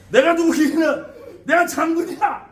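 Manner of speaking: high, loud indignant proclamation; blustering general spirit. Acted. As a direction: announce yourself as an affronted general, hammed up. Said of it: "장군님이 막 놀았어".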